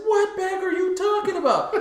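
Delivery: very high, loud incredulous question; retold baffled reply. Replying to a friend about a lost bag, baffled. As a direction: squeal it back, hammed-up disbelief.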